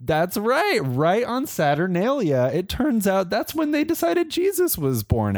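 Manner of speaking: high, gleeful gossip; mock scandal.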